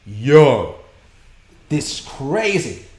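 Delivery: half-whispered then stretched out, amazed; reliving the moment he saw the bugs; amazed quote. Retold or imitated. Streamer retelling his awe at the station, awed and slow.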